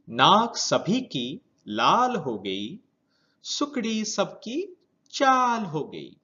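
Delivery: put-on measured children's verse with stretched key words; poem recitation. Teacher reads a winter poem to pupils, lilting poem recitation. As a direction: recite line by line, lilting up and down, pausing between.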